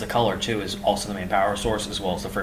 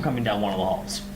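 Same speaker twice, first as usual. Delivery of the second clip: normal talking voice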